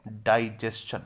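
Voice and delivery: डाइजेशन said "dai-JES-chan"; correct pronunciation. Shown as a demonstration. Teacher gives the right version, डाइजेशन with a "ch" sound. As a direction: end डाइजेशन with a clear "s-chan". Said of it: "इसका सही pronunciation होता है".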